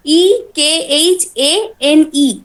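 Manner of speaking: letter by letter, very slowly; spelling out. Shown as a demonstration. Teacher spelling a Bengali word in English letters, spelling out. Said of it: "ইংলিশে লিখতে চাই".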